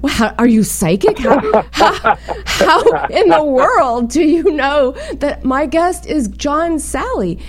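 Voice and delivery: astonished, half laughing; retold amazement.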